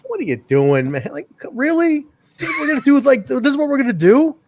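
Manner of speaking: high-pitched, upset commentator impression; Lance Russell. Commentator trying to do an interview, mock upset. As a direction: imitate someone exasperated, pitch climbing. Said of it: "like, when somebody's just upset".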